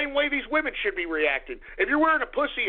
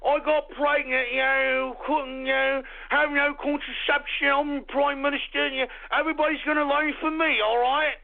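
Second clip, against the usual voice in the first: mock New Zealand accent; 3 semitones higher than usual. Host parodying the prime minister, mock New Zealand accent. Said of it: "yeah, the New Zealand Prime Minister".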